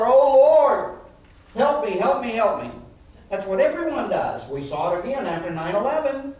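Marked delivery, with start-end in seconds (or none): 0.1-2.7 loud, high acted wail for help; hammed-up plea, high and loud at the start; crying out to God. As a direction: cry out in desperate prayer, then ease off. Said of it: "Everyone come crying to God".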